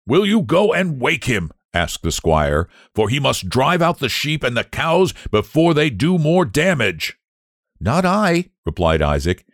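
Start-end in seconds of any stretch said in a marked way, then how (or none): 0.0-1.5 high-pitched, cross squire's request. A narrator's reading. Angry squire orders farm lad. 2.9-7.1 raised pitch, urgent squire; cross squire. A narrator's reading. Angry squire orders farm lad. 7.8-8.5 high-voiced lad saying no; refusing farm lad. A narrator's reading.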